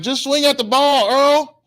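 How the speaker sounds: high, loud, pleading holler; exasperated yell. Acted. Parent to crying Earl at the plate, exasperated yell. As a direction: call out to a hopeless batter.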